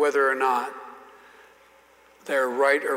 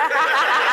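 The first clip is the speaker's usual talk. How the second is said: laugh; 12 semitones higher, 5 dB louder than usual. Real, not acted. Laughing at his own story.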